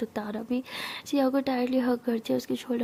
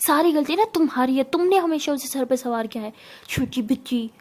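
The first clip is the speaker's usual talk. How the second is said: loud, heated blame; angry yelling; 4 dB louder than usual. Acted. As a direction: scold Tara as if at the end of patience. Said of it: "Siya सिर्फ गुस्से में तारा पे चिल्ला के". (गुस्से में चिल्लाकर डांटना)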